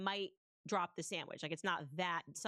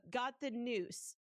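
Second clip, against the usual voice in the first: ordinary delivery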